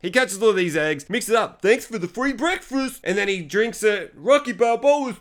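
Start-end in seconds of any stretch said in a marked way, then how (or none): 1.6-3.0 high, taunting put-on kid voice; mocking kid voice. Kid taunting Hubie with a high mocking voice. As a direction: sneer it like a bratty teen.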